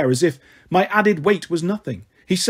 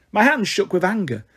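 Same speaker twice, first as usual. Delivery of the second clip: ordinary delivery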